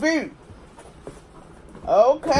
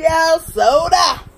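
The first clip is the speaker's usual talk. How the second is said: loud slow announcement; 3 semitones higher, 5 dB louder, slower than usual. Real, not acted. Vlogger calling out to viewers about the soda, loud and slow. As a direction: shout soda slowly and loudly.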